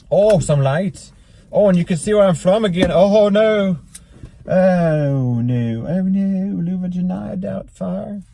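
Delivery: high-pitched comic oh-no voice; hammed-up dismayed outcry. Host spotting lights giving away location, mock dismay. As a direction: pitch it way up, drawing out the ohs. Playful outcry, imitating Mrs. Doubtfire.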